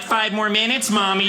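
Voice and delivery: lightly voiced groggy kid; sleepy child. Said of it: "I'm like".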